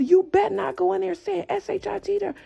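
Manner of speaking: mock angry parent, high and insistent; high-pitched retold scolding; stern threat. Father warning the child before she goes inside, stern threat.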